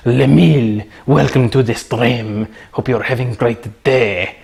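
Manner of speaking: put-on French accent, slowed down. Streamer welcoming a maybe-French viewer, playful French accent. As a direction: greet them in a mock French accent. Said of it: "Maybe you're French".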